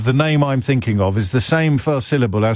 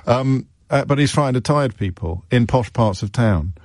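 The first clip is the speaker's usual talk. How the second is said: normal talking voice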